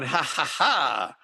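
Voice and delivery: slow, drawn-out mock gloating laugh, then taunt; mock strutting swagger. DJ imitates guys who lord it over others, mock swagger. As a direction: imitate a cocky guy strutting around. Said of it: "strutted around going".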